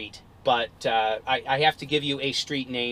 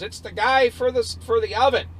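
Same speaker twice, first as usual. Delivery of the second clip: calling upstairs; 8 semitones higher than usual. Retold or imitated. Kid calls upstairs to parents.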